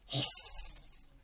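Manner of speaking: a sharp, unvoiced hiss of steam. A narrator's reading. Flora's water hitting the hot pot, a steam hiss.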